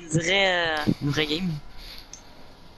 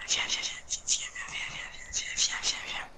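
urgent whispered pleading; whispering; 11 dB quieter than usual. Real, not acted. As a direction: whisper it, coaxing fast.

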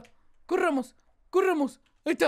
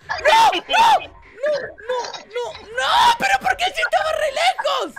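panicked screaming; 10 semitones higher, 8 dB louder, slower than usual. Real, not acted. Killer catches up in game.

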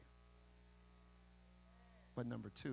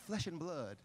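Wayne Chaney translation. Pretend. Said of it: "That was the Wayne Chaney translation".

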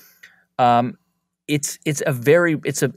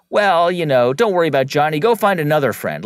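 loud, higher dismissive parent; wrong parent reply; 4 semitones higher, 7 dB louder than usual. Pretend. Parent dismissing the child's complaint, loud and dismissive. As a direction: brush it off like a hurried parent. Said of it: "you shouldn't say".

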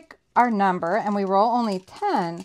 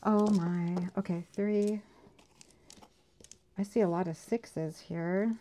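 ordinary delivery

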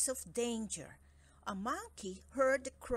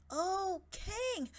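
nervous monkey; 10 semitones higher than usual. Acted. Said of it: "He came before him nervously".

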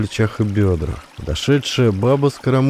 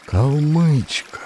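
slow, drawn out stunned recognition; shocked gasp of a word; 5 semitones higher, much slower than usual. A narrator's reading. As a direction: let it fall out in shock. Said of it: "и все ахнули". (поражённо, протяжно)

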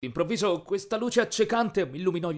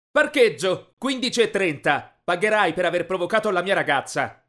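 loud, raised-pitch challenge; angry threat; 5 semitones higher, 7 dB louder than usual. A narrator's reading. Boyfriend threatening the boy over his girlfriend, loud and angry. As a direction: order him to a showdown.

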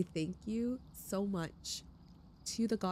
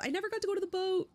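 excited outburst; 12 semitones higher, 3 dB louder, much faster than usual. Real, not acted. Sudden outburst at the game ending.

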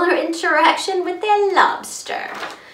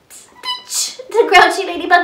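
plain reading voice

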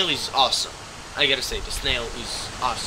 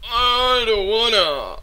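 mock whine; 8 semitones higher, 6 dB louder, slower than usual. Whining again.

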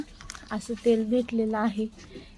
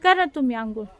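raised, higher voice calling across the yard; loud friendly call to a relative; 5 semitones higher, 6 dB louder than usual. Real, not acted. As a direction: call loudly to someone outside. Calling to family member outside.

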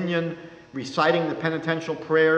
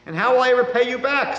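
high, louder, groaning widow's question; sick widow groaning, higher and louder; 9 semitones higher, 6 dB louder than usual. A narrator's reading. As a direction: moan out her worry about paying.

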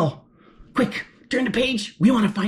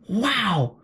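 ordinary delivery

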